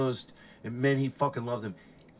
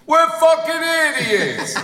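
band shouting; 12 semitones higher, 8 dB louder, slower than usual. Retold or imitated. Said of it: "the whole band stands up and goes".

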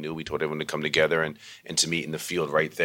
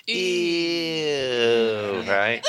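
put-on kids going ew; 7 semitones higher, 4 dB louder than usual. Kids reacting to love talk.

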